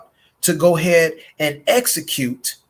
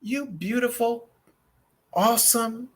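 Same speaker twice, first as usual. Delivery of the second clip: tender king; 8 semitones higher, 5 dB quieter than usual. Pretend. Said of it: "And he says".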